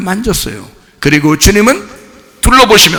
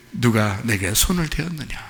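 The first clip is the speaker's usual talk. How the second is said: lower, softer, tender question; gentle Jesus; 6 semitones lower, 8 dB quieter than usual. Shown as a demonstration. Jesus gently seeking the woman, soft and tender. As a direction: ask kindly, already knowing the answer. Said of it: "그럼 몰라서 그래?".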